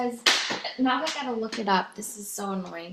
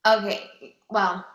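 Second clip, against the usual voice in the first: normal talking voice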